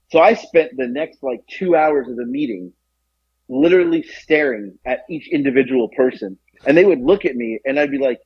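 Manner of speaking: ordinary talk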